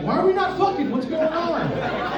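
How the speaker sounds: high-pitched, exasperated mock plea; mock indignant complaint. Boyfriend to girlfriend once home, high mock-indignant complaint.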